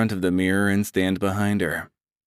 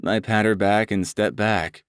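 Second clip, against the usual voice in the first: plain reading voice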